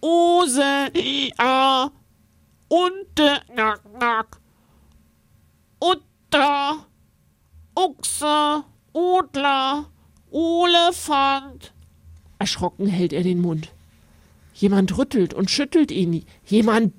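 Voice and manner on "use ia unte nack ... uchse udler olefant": hoch, mit zugehaltener Nase trompetet; trompetend. A narrator's reading. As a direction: trompete die Wörter durch die zugehaltene Nase. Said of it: "Er hält sich die Nase zu und trompetet los". (laut trompetend durch die Nase)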